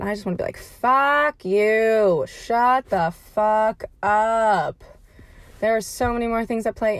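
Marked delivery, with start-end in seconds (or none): 0.6-4.8 loud, slow, spat-out retort; loud, drawn-out mock telling-off; angry retort. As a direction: tell the preachy posters off, dragging it out. Imagined retort to preachy posts.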